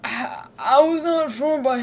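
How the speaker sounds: lightly put-on father voice; Artorius Diondal. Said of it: "Can't wait to do his voice".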